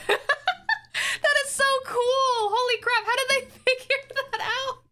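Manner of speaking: high, laughing awe; amazed delight. Real, not acted. Streamer to chat, gushing high and laughing. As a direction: gush over the trick.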